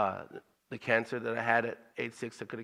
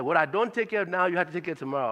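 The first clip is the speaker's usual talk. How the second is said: higher, louder Liberian accent imitating dad; father's Liberian accent; 6 semitones higher, 4 dB louder, faster than usual. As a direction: repeat it insistently in dad's Liberian accent. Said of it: "I'm gonna try to do the accent".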